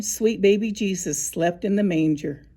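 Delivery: ordinary delivery, nothing marked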